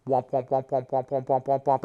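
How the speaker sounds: low droning mock womp womp; womp womp noise. Instructor mimicking a dazed victim, low droning womp womp. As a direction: drone a dopey womp womp. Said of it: "then he goes".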